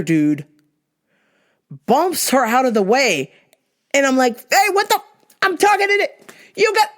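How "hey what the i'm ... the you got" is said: high-pitched sputtering protest; mock outraged squeal; retold indignation. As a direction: protest in a high voice, breaking off mid-phrase. Retold protest at being bumped.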